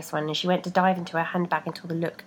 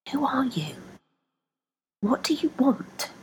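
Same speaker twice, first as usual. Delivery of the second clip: higher, hushed whisper; 6 semitones higher than usual. Acted. Woman whispering to her own feet, hushed.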